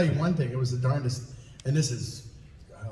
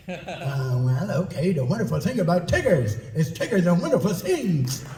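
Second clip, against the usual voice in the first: Tigger; 3 semitones higher, 4 dB louder than usual. Shown as a demonstration. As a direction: bounce through it like an exuberant cartoon animal. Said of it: "now give me some Tigger".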